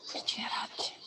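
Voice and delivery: quick whispered aside; whispering. Real, not acted. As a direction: whisper it under your breath. Aside muttered during a live call.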